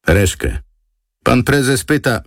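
high-pitched underling on a call; aide's phone voice. A narrator's reading. Aide phoning Reszke for the boss, high-pitched underling voice.

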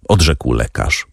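usual speaking voice

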